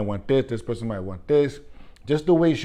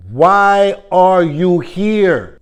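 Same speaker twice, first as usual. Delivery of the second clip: louder, slow, drawn-out why; incredulous question; 4 semitones higher, 8 dB louder, much slower than usual. Retold or imitated. Speaker asking the buyer why she came, loud and slow. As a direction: demand it in slow disbelief.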